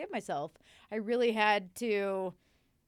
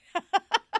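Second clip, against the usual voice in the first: high, loud burst of laughter; 12 semitones higher, 10 dB louder than usual. Real, not acted. Confessing to going wild, she laughs loudly.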